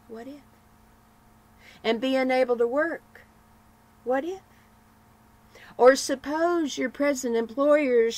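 a hint of a gloomy, nagging churchwoman; gloomy lady. Gloomy lady needling Nancy with worries, mildly nagging voice.

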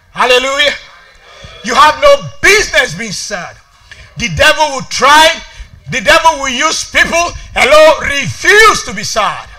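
loud, emphatic sermon delivery; forceful preaching. Real, not acted. Preacher urging the church to refuse sadness, forceful preaching.

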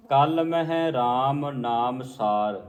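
slow recitation, slight break after ਮਹਿ; rest on ਮਹਿ. Shown as a demonstration. Teacher modelling the line, slow with rest on ਮਹਿ. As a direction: draw out the words, resting at ਮਹਿ. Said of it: "ਮਹਿ ਤੇ ਵਿਸਰਾਮ ਹੈ".

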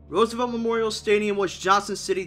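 ordinary delivery

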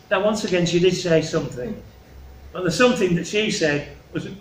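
ordinary delivery

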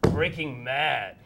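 slow, loud, angry declaration. Acted. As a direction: bellow it slowly like a rage. Stage two, the worse form.

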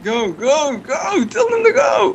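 high, exasperated yell; frustrated shouting. Real, not acted. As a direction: shout in disbelief they won't move. Frustrated yelling teammates won't go.

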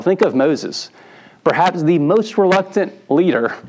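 ordinary talk

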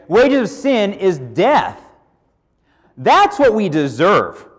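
each word drawn out and weighted; slow emphatic repeat. Real, not acted. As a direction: lean on the word wages, slowly. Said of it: "preacher repeats the scripture slowly".

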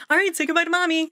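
put-on high, quick sing-off to a child; exaggerated chirpy send-off; mock cheery parent. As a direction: imitate a parent breezily hurrying a child along. Parents' breezy send-off acted out.

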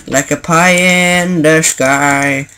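slow, drawn-out silly voice; playful flourish. Pretend. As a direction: stretch every word out for comic effect. Playful flourish on his own simile.

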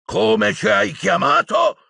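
high, slow, outraged cry; wild centaur shouting back; angry shout. Acted. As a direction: yell at her, offended. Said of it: "gridò un centauro nero".

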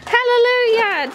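high, loud triumphant call; triumphant cheer. Real, not acted. Mum calling out to Frederick that the chores are done, high and loud. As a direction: announce it like a victory.

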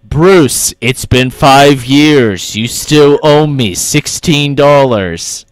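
very slow, loud and menacing; intimidating. Pretend. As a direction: threaten them slowly and loudly. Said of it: "That's, like, intimidating".

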